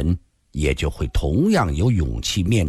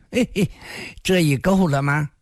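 high-pitched mocking little laugh into a question; cold sneer; 9 semitones higher than usual. A narrator's reading. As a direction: sneer it with a scornful "heh-heh". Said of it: "冷笑地说道". (冷笑讥讽)

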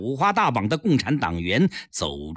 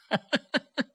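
high, staccato mocking cackle; cold sneering laugh; 13 semitones higher, 5 dB quieter than usual. A narrator's reading. Captor hears demands for a trial, cold laugh. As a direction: laugh scornfully at their demand. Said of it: "赖锦元冷笑道".

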